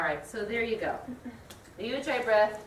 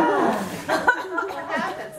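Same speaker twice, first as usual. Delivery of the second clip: slow laughing question, high and loud; 9 semitones higher, 9 dB louder, much slower than usual. Real, not acted. Teacher laughing at the class after a round of sighs. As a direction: ask it through the laughter. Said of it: "laughing after sighs".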